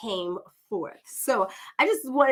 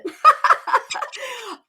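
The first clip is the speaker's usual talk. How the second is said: burst of laughter; 13 semitones higher, 8 dB louder than usual. Real, not acted. Overjoyed at introducing her cousin.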